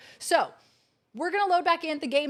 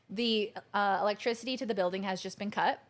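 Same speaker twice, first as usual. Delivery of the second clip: ordinary talk, nothing marked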